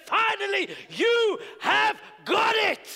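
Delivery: God's triumphant finally. Retold or imitated. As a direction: proclaim it like God rejoicing. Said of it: "then he claps and said".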